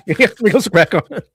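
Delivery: high, quick words through laughter; laughing. Real, not acted. Streamer echoing co-host's police joke, laughing. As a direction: crack up while repeating the line.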